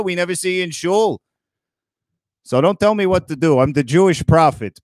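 normal talking voice

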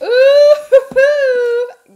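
high, loud delighted ooh with a laugh; excited squeal. Real, not acted. Opening her friend's clothing box, a loud excited squeal.

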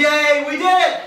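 high, loud celebration; excited shout. Real, not acted. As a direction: cheer at the finish. Finishing the pull-ups.

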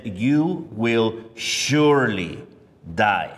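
slow, weighty stress on surely; slow solemn warning, surely stressed; forceful and definitive. Acted. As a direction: lay each word down with God's full force. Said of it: "much more forceful and definitive".

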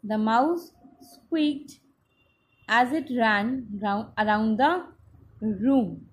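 slow, deliberate dictation, squeaked stressed. Shown as a demonstration. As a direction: spell it out slowly for the class. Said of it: "What did the mouse do?".

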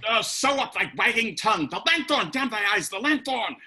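Will urging Kit to hide, panicked; urgent panic. Acted. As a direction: hurry him into hiding. Will frantic about the lantern.

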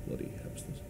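ordinary delivery

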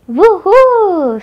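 high, loud whoop of delight; excited cheer. A narrator's reading. Boy on hearing of fish and chips, cheering. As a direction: whoop with joy.

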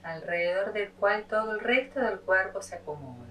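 measured meditation voice with long pauses; unhurried yoga teacher leading a body scan; guided meditation. Acted.

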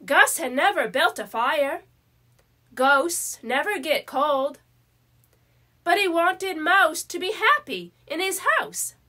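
ordinary delivery, nothing marked

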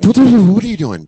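startled panic. Retold or imitated. After sex she touches him, high startled yelp. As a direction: jolt like you've been touched.

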